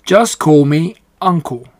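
a lightly voiced big, hearty pig. Uncle the big pig introducing himself to Charlotte, hearty. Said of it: "he said in a big, hearty voice".

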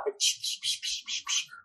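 whispered psh, psh spray noises; squirting sound. Shown as a demonstration. [name] mimicking the dog squirting in his car, whispered psh. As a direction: hiss out the squirts softly. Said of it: "aquela coisa de esguicho".

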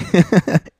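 high, loud burst of laughter. Real, not acted. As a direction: burst out laughing at your own flub. Fumbled ending amuses him.

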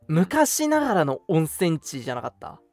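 normal talking voice

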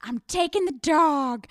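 loud, high-pitched movie-line impression; Legally Blonde quote. Host quoting Legally Blonde, loud and defiant. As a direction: declare it like a triumphant film quote. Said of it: "that thing from Legally Blonde".

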